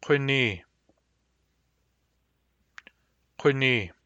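rounded back-of-throat q in "Quini". Shown as a demonstration. Teacher giving the round Q example, lip-rounded "Quini". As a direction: start "Quini" far back with rounded lips. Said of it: "Round Q".

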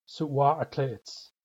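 Suwa'atla'its drawn out very slowly, each syllable separate; slow model pronunciation. Shown as a demonstration. Teacher slowly modelling Suwa'atla'its for learners. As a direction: spell Suwa'atla'its out with the voice. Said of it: "Let's build this one".

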